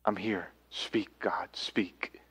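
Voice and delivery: soft, near-whispered plea to God; hushed prayer. Pretend. Believer answering God, soft and hushed. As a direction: breathe it as a humble prayer. Praying surrendered response to God.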